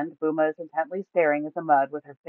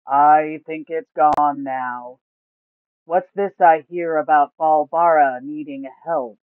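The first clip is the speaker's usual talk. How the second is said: slow Gar; 7 dB louder, slower than usual. A narrator's reading. Giant turtle Gar waking up, speaking slowly. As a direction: drag each word like a sleepy giant.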